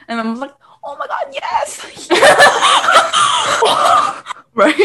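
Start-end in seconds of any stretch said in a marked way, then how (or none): 0.8-2.1 very high, squeaky imitated yes; mock delight. Imitating mom saying yes to sister's phone, high mock delight. 2.1-4.3 shrieking burst of laughter; loud high laughter. Real, not acted. Friends laughing at the mom impression, high shrieking laughter. Group reacts to mom's yes.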